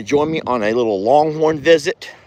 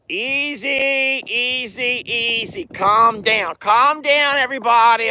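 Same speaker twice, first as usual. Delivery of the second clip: soothing the herd; 7 semitones higher than usual. Real, not acted. Calming the animals.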